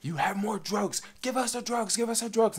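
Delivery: high, pushy mock demand; imitating soldiers shouting demands at the tourist; aggressive soldiers demanding. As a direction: hammer him for the drugs. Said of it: "They're like".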